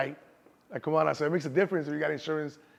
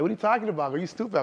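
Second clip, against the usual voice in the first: raised, louder mock snap at mom; annoyed retort; 6 semitones higher, 4 dB louder, faster than usual. To his mom, a loud exasperated retort. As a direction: brush off mom's worry, loud and higher. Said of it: "I'm like".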